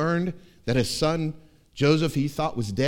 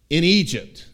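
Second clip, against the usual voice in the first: normal talking voice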